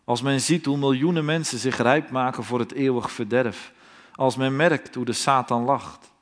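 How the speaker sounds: usual speaking voice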